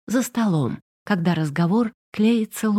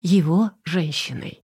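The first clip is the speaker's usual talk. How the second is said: ordinary delivery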